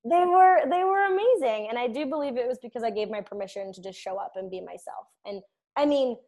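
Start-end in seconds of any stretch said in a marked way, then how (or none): 0.0-1.7 high, loud, laughing through words. Real, not acted. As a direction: giggle out the words happily. Laughing after wedding-day joke.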